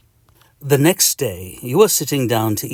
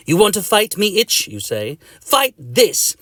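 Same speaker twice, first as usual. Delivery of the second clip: defiant challenge; 9 semitones higher, 4 dB louder than usual. Acted. Patient challenges the itch.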